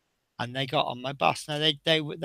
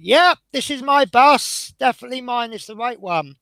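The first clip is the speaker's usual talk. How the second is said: mock confident; 8 semitones higher, 7 dB louder than usual. Driver pranking colleagues as a blind man, cheerfully sure.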